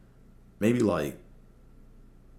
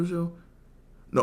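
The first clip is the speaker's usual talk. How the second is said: ordinary talk, nothing marked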